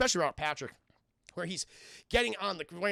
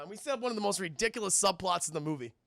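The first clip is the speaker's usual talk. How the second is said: ordinary talk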